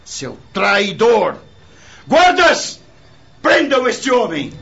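furious shout at the guards; roaring tyrant king. Acted. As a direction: bellow the order at full force. Lord orders the traitor's arrest.

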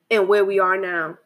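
ordinary talk

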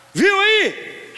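calling out. Retold or imitated. Pai pergunta alto.

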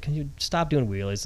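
ordinary talk

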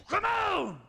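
a huge, high shout; desperate all-out scream of effort; frantic shout. Acted. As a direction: yell it with everything as if pounding a chest to restart a heart. Acting out frantic chest compressions.

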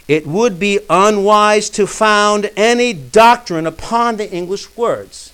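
loud, slow declaimed quotation; emphatic quote reading. Acted. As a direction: read it out slowly, high and forceful. Reads a quotation aloud.

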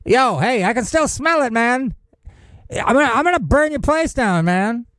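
put-on high whiny drunk neighbor on phone; tinny talking telephone. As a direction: whine it down the phone line. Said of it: "Rudd started ringing him".

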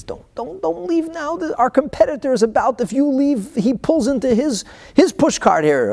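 put-on old market woman begging the girl to stay, high and pleading.

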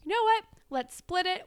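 a playful guy voice proposing to share; cute boy offering. Retold or imitated. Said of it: "And he's like".

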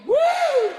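very high, loud whistle-like woo; whistle whoop. Acted. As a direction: blow it like a whistle. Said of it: "put a whistle in my dick".

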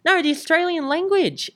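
high-pitched mock of a clueless classmate; imitated naive questioner, high voice. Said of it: "And he goes".

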